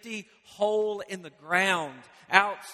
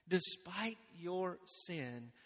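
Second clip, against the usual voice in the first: much softer and slow, God reassuring his people; tender God voice; 11 dB quieter, slower than usual. Acted. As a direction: say it hushed and slow, like a promise. God's voice speaking to Israel.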